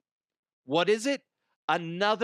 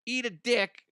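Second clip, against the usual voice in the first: defiant; 9 semitones higher than usual. Real, not acted. Podcaster telling his online critics off, mocking and defiant. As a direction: tell the critics off with a sneer. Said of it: "defiant jab at critics".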